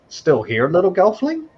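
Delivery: high, loud, scolding character voice; impatient creature mentor. Acted. Augur looks at the lingering player, impatiently shooing.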